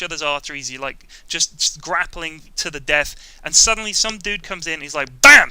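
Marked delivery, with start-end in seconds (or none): 5.2-5.5 sudden loud punchy "bam!"; loud sound-effect bam. Retold or imitated. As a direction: slam the word out like a hit. Acted sudden attack.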